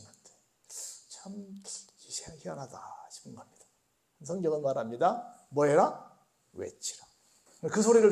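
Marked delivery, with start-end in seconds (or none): 1.1-3.6 whispered puzzled remark; 속삭이듯 의아해하며. Real, not acted. 6.5-7.1 low whispered command; 낮게 속삭이듯 "외치라"; hushed. Real, not acted.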